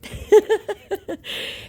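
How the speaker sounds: high giggling burst; real laugh. Real, not acted. Woman reacts to a scammer's voice note, laughing.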